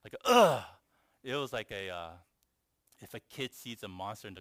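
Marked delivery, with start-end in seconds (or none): 0.2-0.6 short, loud, high "ugh" yell; demonstrated grunting yell. Shown as a demonstration. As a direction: burst out a sudden loud yelp. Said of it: "I didn't scream like a".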